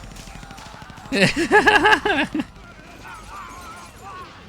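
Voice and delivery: loud high laugh; high cackling laugh. Real, not acted. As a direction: burst out laughing.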